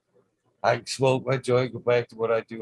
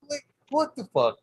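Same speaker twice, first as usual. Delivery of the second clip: much higher, amazed; incredulous; 13 semitones higher than usual. Real, not acted. Watching the toad leap into the tent, amazed and high. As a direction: marvel at the toad's leap.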